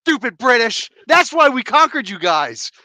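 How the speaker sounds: loud, high mock rant; mock outrage. American ribbing British friends at the table, mock outrage. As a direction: scold them in pretend indignation.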